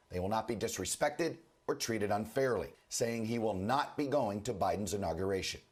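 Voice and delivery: ordinary talk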